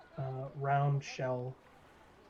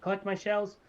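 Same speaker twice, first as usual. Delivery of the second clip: higher, nervous then proud little barber; gnome barber; 4 semitones higher, 3 dB louder than usual. Acted. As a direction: fret about danger, then boast your name. GM voices the barber.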